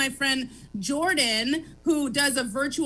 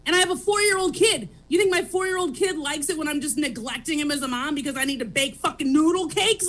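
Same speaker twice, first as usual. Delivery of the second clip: ordinary delivery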